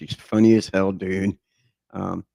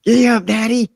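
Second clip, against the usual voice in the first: put-on much higher, louder little-boy voice; five-year-old kid; 14 semitones higher, 6 dB louder than usual. Man retelling how as a small boy he rode his dad, high kid voice. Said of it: "I was like".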